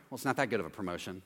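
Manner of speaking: low, quick, grudging brush-off; mock jealous dismissal. Jealous fool belittling your promotion, low and quick. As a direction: play down the news like a jealous friend. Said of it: "and they're like".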